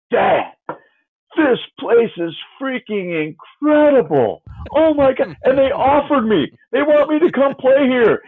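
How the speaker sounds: put-on a kid bursting with joy after the campus visit; thrilled son.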